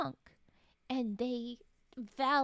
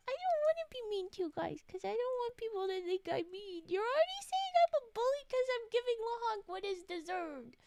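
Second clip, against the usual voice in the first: mock whine; 8 semitones higher than usual. Mock defending herself.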